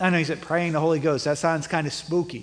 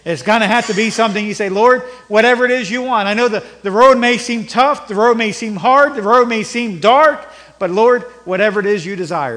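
higher, louder pleading prayer; impassioned preaching prayer; fervent prayer; 5 semitones higher, 8 dB louder than usual. Acted. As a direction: cry out to the Lord with conviction. Voiced prayer, rising fervor.

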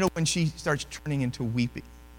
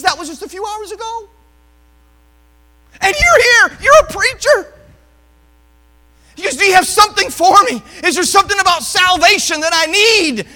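tearful plea; 13 dB louder than usual. Retold or imitated. Woman weeping, pleading for salvation.

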